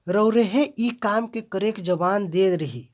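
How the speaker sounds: रौरेहे loud and emphatic; stress on रौरेहे. Shown as a demonstration. Teacher demonstrating pronoun stress, रौरेहे loud. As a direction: press the pronoun रौरेहे hard. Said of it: "सर्वनाम पर जोर दिया गया है".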